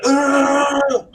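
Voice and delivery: short high groan of hunger; starving groan. Retold or imitated. Retelling his hunger while making sushi, a pained groan. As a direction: let out a famished ugh. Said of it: "I was just like".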